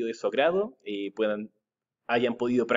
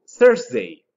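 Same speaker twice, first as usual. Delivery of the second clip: "Thursday" called out much louder and higher, falling off; loud model word; 10 semitones higher, 10 dB louder than usual. Shown as a demonstration. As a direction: announce "Thursday" boldly to the class. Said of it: "Next day is".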